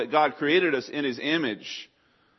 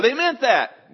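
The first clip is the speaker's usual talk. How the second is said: higher, louder, drawn-out objection; mock quibbling; 12 semitones higher, 9 dB louder, slower than usual. Arguer twisting scripture's meaning, mock whiny quibble. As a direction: play a nitpicker hedging. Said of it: "There's almost no way to argue".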